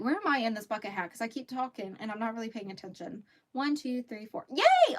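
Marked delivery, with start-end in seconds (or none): 4.5-5.0 high squeal of delight; excited cheer. Real, not acted. Counting her stitches on the beanie, a high excited yay. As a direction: celebrate like you just nailed the count. Row count comes out right.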